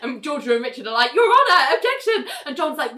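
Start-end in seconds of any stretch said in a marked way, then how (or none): 1.1-2.3 high-pitched mock courtroom outcry; hammed-up indignant objection, half laughing; acted protest. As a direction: jump in with a squeaky appeal. Lawyers protest to the judge.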